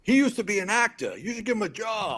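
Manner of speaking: high-pitched eager plug; pushy enthusiasm. Retold or imitated. Drunk friend touts fireman to actress, pushy and excited. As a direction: imitate a tipsy friend's blurted pitch.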